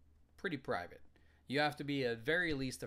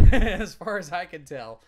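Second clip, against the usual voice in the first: cracking up mid-sentence; laughing; 6 semitones higher, 25 dB louder than usual. Real, not acted. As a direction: burst out laughing while speaking. Restarts after "hey, focus", bursting out laughing.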